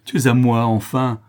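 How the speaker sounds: loud triumphant roar; savage roar. Acted. The notary roars as he turns the lock. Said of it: "avec un rugissement sauvage".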